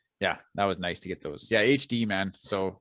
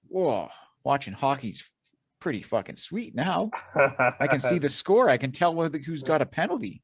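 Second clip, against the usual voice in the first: higher, delighted dad voice; amazed dad; 5 semitones higher than usual. Retold or imitated. Son imitating his dad amazed by HD hockey, higher and delighted. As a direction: gush like dad seeing HD hockey. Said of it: "My dad was like".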